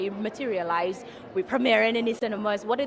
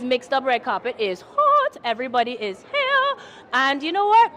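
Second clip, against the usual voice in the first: red carpet host; 3 semitones higher, 8 dB louder than usual. Acted. Host's loud red carpet patter.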